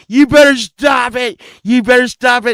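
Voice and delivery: high, loud retold yelling; angry warning. Rock star on the car warning the driver, angry shout. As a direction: act out a frantic demand.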